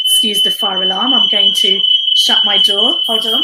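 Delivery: loud over the blaring alarm; shouting over fire alarm. Real, not acted. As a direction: raise your voice over the alarm.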